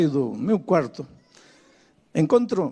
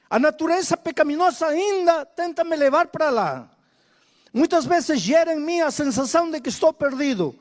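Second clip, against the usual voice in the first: raised, fervent reading of the verse; scripture recitation; 6 semitones higher than usual. Acted. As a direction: proclaim the text to the church. Preacher reciting Romans from pulpit.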